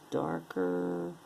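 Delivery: mock-deep growl of a line. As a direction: drop into a deep voice for "darker". Deep voice for darker bracelet.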